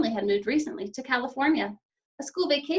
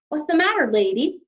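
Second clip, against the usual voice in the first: louder, higher teasing cop; mocking policeman; 6 semitones higher, 7 dB louder than usual. A narrator's reading. Policeman mocks Missus Lambchop on the sidewalk. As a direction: tease the lady like a smug cop. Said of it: "the first policeman asked".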